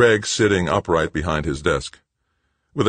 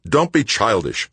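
sharp, louder put-down; snapped rebuke; 8 semitones higher, 5 dB louder than usual. A narrator's reading. Director snapping at his younger brother, sharp rebuke. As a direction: snap it dismissively. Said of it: "Greg snapped".

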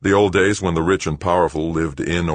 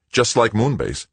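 higher-pitched, mildly snappish retort; snapped; 7 semitones higher, 4 dB louder than usual. A narrator's reading. Greg retorting to Joanna about profit, snapped. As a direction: snap it back, pitched up. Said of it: "Greg snapped".

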